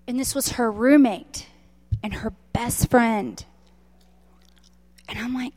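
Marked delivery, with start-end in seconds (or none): none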